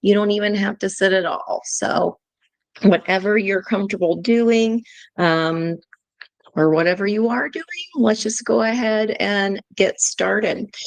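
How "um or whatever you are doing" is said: ordinary talk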